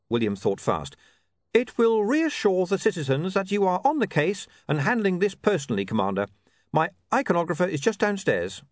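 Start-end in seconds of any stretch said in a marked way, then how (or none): 1.5-8.6 higher-pitched, earnest character voice; persuasive reporter. Acted. Reporter persuades commander to pose for a picture, earnestly. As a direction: coax him with a quick argument.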